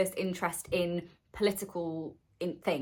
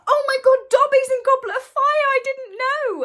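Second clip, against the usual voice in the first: much higher and louder, gasping in delight; shocked excitement; 10 dB louder than usual. Retold or imitated. Reading the books, thrilled to find Dobby there, high excited squeal. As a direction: squeal it like a fan who just found out, slowing down at the end. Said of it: "And I was like".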